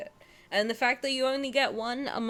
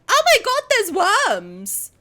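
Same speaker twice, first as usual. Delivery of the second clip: much higher, louder shriek of surprise; mock shock; 10 semitones higher, 10 dB louder, slower than usual. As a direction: shriek like you spotted worms.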